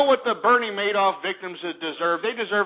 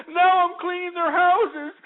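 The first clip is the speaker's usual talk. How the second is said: much higher, slow, whimpering mock sob; mock crying; 11 semitones higher than usual. Mocking a crying Madoff victim on TV, hammed-up sobbing. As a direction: ham up a sobbing rich woman. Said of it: "she was sitting there crying".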